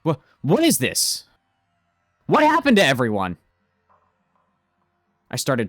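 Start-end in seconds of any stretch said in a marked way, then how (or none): 0.0-3.4 loud, stammering shock. Acted. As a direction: recoil at what you just saw. Sees the shocking video.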